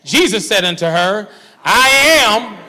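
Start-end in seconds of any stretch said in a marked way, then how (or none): 1.6-2.6 loud, high ringing-out of scripture; emphatic declaration. Acted. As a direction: proclaim it big to the congregation.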